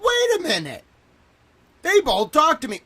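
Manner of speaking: high, loud retelling of the penny dropping; alarmed realisation. Retold or imitated. Retold own realisation, rising alarm.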